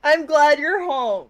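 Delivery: high, loud frightened voice; relieved but scared; shaky relieved character. Acted. Acted scared character line.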